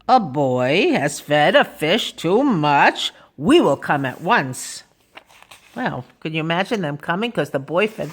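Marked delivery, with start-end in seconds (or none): 0.1-4.8 low fireman's reply, slow at first; deep-voiced fireman. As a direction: answer deep and deliberate, as the fireman. Fireman answers the radio call.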